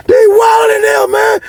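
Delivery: high, drawn-out exclamation; amused disbelief. Real, not acted. Reacting to the burnt pants mishap.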